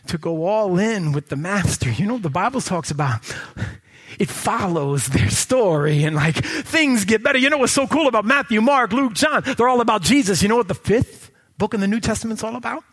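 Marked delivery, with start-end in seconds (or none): 6.2-12.8 loud high preaching, shouting phrases; full-force preaching. Acted. Preacher shouting to the church about the Acts. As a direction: drive it home to the congregation.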